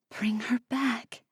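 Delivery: soft whispered plea; pleading whisper. A narrator's reading. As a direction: plead under your breath. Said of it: "I pleaded with myself".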